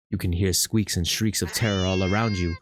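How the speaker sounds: low, ominous narration; game master scene setting. A narrator's reading. Game master describes the fight, ominous narration.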